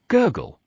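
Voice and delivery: high sleepy gurgle; high little gurgle; gurgle sound. Acted.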